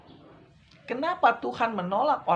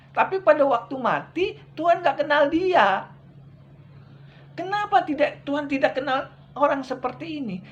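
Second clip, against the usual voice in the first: fiery preaching; 4 semitones higher than usual. Acted. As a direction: preach it hard to the congregation. Preaching at full force. (khotbah berapi-api dengan suara tinggi)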